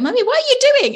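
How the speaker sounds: put-on high, loud kid's question; imitating curious child. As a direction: pipe up like a child questioning mommy. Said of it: "So they're like".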